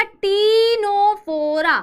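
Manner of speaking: "टीनोफोरा" with every syllable stretched; drawn-out "टीनोफोरा", starting on t; slow model pronunciation. Shown as a demonstration. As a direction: say "टीनोफोरा" very slowly for students. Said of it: "क्या बोलते हैं बेटा".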